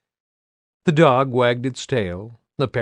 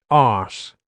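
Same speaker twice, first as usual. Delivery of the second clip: put-on "Arse" said with a broad islander accent, long open a, no r; Australian-ish islander accent; 5 dB louder than usual. Bernie proving her islander accent, broad "Arse". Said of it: "You got that accent".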